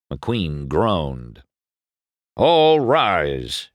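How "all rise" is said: raised, higher court announcement; calling out. A narrator's reading. Sheriff announcing the judge to the court, calling out. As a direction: call the room to its feet. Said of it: "Sheriff called".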